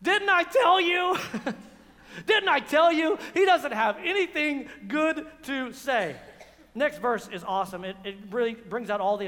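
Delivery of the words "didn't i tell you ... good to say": king vindicated to Jehoshaphat; gloating. Acted. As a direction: crow with glee. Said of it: "what does Ahab say".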